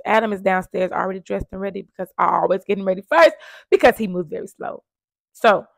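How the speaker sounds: ordinary talk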